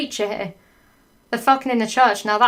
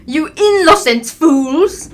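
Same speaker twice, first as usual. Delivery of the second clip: high, loud, slow hammed-up yell; Professor McGoggle; 6 semitones higher, 8 dB louder, slower than usual. Teacher yelling at the caught couple, drawn-out shriek. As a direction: stretch it into a scandalised shriek. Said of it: "yelled Professor McGoggle".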